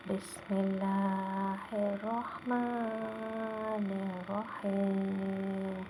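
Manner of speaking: slow, drawn-out melodic recitation; Quran recitation. Real, not acted. Teacher opens the first lesson, slow Arabic recitation. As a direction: chant it slowly, holding the long vowels.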